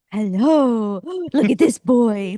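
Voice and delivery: gasping, drawn-out adoring hello; gushing dog greeting. Retold or imitated. Meeting a dog on the street, gushing. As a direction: gush over a dog between gasps.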